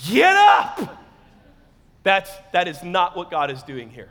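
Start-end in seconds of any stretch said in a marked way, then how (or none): 0.1-1.0 loud high shout; yelled wake-up. Acted. Dad ending the ball game with son, shouting. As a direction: bellow it to rouse a sleeper. Dad's final yell at son.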